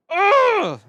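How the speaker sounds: loud, high-pitched ugh; disgusted groan. Real, not acted. Host opening the tarantula package, disgusted groan.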